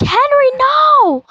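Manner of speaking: high, drawn-out cry of dismay; mock horrified cry. Watchers see Henry falling to his death, mock horror. Acts onlookers as Henry falls.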